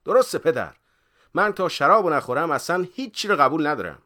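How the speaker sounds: loud, high protest; cheeky pupil. A narrator's reading. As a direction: insist stubbornly to the teacher. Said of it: "و یارو گفت".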